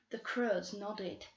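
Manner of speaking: plain reading voice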